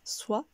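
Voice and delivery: SOIT with the voice going up; rising tune. Shown as a demonstration. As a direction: lift the pitch on soit. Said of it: "la voix monte".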